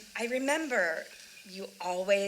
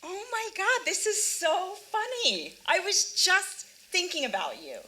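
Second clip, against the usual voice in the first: excited; 9 semitones higher, 4 dB louder than usual. Acted. Surprise run-in with old friend.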